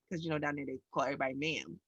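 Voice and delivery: normal talking voice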